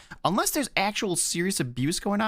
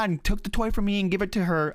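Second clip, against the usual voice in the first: ordinary talk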